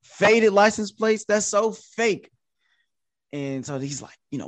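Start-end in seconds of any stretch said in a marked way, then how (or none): 0.0-2.3 retold exasperation, climbing as it goes; annoyed and irritated. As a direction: push back, annoyed and incredulous. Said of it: "annoyed and irritated".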